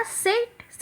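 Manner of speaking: usual speaking voice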